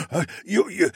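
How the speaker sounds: gasp, then high-pitched stuttering; shocked stammer. A narrator's reading. Polar bear stepping back, shocked stammering. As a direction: gasp and trip over the words.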